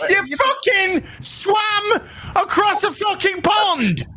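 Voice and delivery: high, slow, shouted tease; teasing shout. Real, not acted. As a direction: yell it like it's absurd. Teasing friend over jet lag.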